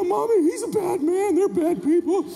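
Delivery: put-on high-pitched upset child voice; whining little boy. Little boy tells mom about the bully, whiny. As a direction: tattle to Mommy in a high voice. Child complaining to his mother.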